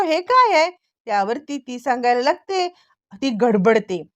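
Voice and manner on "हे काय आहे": sudden high, louder alarmed question; startled question. Retold or imitated. The hero seeing blood on her hand, startled and raised. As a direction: blurt it out, shocked at the blood.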